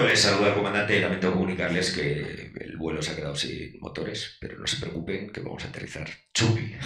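mock cabin announcement; pilot announcement. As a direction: announce it like an airline captain over the intercom. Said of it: "Es piloto".

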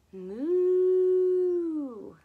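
loud, high mooing like a cow. Pretend. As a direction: moo out like a cow for the kids. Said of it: "The cow says".

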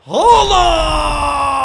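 high, loud goal scream of the name; goal cry. Real, not acted. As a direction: shout it as the ball hits the net. Celebrating a goal.

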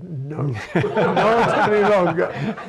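slow, amused no through a laugh; laughing refusal. Retold or imitated. Editor in New York turning down the epigraph, quoted laughing and slow. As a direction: laugh it off while quoting the editor.

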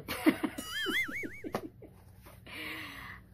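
long, very high-pitched cackle; high squealing laugh. Real, not acted. Laughs at her singer award joke.

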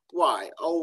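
ordinary delivery

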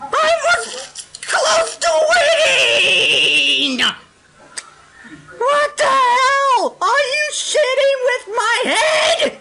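slow, drawn-out outraged yelling; mad. Real, not acted. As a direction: wail it out in furious disbelief. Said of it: "I'm so mad".

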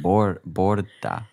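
slow, halting Welsh-accented "borda"; attempted Welsh accent. Shown as a demonstration. Host trying a Welsh greeting, unsure Welsh accent. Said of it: "how would you say that in a Welsh accent".

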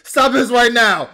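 high, loud imitation of the clip's shout; mock yell. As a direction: yell it like the guy in the clip, slow on the second part. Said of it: "the way he's like".

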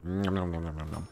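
soft, low nom noises; munching sounds while the character eats; mock munching. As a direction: pretend to chew happily.

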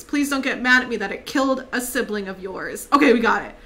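ordinary talk, nothing marked

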